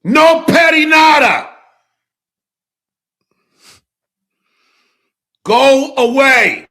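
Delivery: full, high-pitched shout; yelling. Real, not acted. Streamer drives off returning delivery driver, yelling. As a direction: bellow to drive them from the door. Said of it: "[name]'s yelling at".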